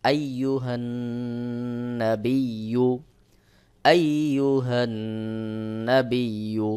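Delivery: very slow "আইয়ুহান্নাবিয়্যু" with long hummed n; ghunnah recitation. Shown as a demonstration. Teacher models ghunnah, "আইয়ুহান্নাবিয়্যু" drawn out on the nasal. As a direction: hold the n of "আইয়ুহান্নাবিয়্যু" through the nose. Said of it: "অবশ্যই গুন্নাহ করতে হবে".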